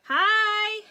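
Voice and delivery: loud, high, drawn-out hi; excited greeting. Real, not acted. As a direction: call out a hello to a newcomer. Greets someone who joins the live.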